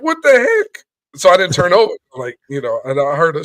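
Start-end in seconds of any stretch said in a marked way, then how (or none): 0.0-0.8 high-pitched mock alarm; startled reaction. Retelling his reaction to stuff falling in the night, startled. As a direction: exclaim it high, as if startled awake.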